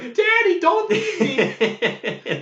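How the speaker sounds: put-on shrill falsetto child wailing, stretched out; the child begging his dad to stay, hammed-up falsetto; kid voice. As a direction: wail it like a little kid, breaking into a laugh. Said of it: "I did voices for every character too".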